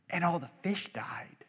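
breathy hushed whisper, voice dropped away. Acted. Preacher imagines Pharaoh's thoughts, hushed whisper. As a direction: let it fall into an awed whisper. Whispered reaction to Nile plague.

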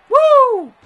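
long, loud, very high whoop. Real, not acted. Player mid-match, high whoop. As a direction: let out a piercing whoop. Whoop during game action.

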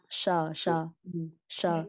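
"sờ" as sh with curled tongue, low; heavy retroflex s. Shown as a demonstration. Teacher shows standard Vietnamese s, retroflex "sờ". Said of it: "Giống như chữ sờ nặng".

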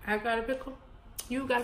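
chewing while talking; talking around food; mouth full. Real, not acted. Seen 1: Holds pickle by mouth, lips pressed chewing around 0.6–0.8 s.

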